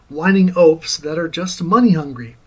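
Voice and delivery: ordinary talk, nothing marked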